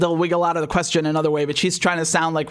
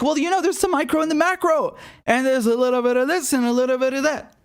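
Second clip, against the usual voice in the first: higher, hammed-up airy politician voice; mocking word salad; 6 semitones higher than usual. Commentator parodies congresswoman's evasive answer, higher mocking voice. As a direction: toss it off like a dodging politician. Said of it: "And now she's like".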